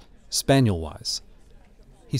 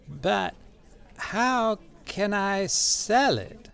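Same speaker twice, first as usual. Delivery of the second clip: very slow, soft, high character voice; said slowly; 9 semitones higher, 5 dB quieter, much slower than usual. A narrator's reading. Old man baffled by the offer, slow and soft. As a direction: stretch each word with a pause after the first. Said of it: "and said slowly".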